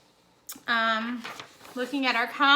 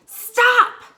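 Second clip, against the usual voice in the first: loud, high sudden shout; shouted stop; 11 semitones higher, 14 dB louder than usual. Shown as a demonstration. Teacher demonstrating an exclamatory command, shouted. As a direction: yell it like an emergency. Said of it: "with an exclamation mark".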